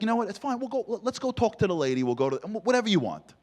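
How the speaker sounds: high mock-sweet wife voice; wife voiced.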